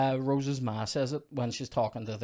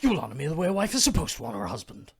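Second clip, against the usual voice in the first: hammed-up angry husband, quick and raised; mock rage; 4 semitones higher, 5 dB louder, faster than usual. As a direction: spit it out fast in mock fury. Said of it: "Oh, rage".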